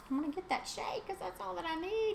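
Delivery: high playful quoted thought; high mock self-talk. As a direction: toss it off in a high, chirpy mock voice. Voicing herself in a sales story.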